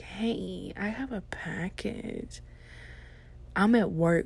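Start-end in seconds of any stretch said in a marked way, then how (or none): 0.0-2.4 soft, slightly drawn-out put-on greeting; imitating a flirty customer. Woman flirts with mail clerk at pickup, soft imitation. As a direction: put on her voice, quieter and slower. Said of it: "imitate her".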